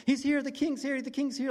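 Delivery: high, loud burst of news; excited crowd rumor. Acted. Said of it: "imagine people going through the room".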